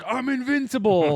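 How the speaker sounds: high, mock-crazed boast; Hitler. Hitler after surviving the bomb, crazed. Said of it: "made Hitler, like, crazier".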